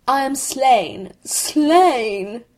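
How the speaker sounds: slow, high melodramatic death cry; hammed-up Shakespearean agony, drawn out; tragic cry. A narrator's reading. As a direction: wail it out like a dying stage hero. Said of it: "cried the accursed fruitcake".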